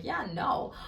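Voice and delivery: acted self-assured no; shameless refusal. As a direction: stand up for yourself without shame. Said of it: "shameless and be like".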